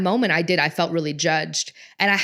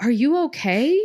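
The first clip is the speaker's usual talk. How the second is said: put-on high, sing-song soothing to a small child; calm and soothing; 7 semitones higher, 3 dB louder than usual. Mom comforting her daughter after a fall, high soothing voice. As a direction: reassure a hurt toddler gently. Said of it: "I went over really calmly".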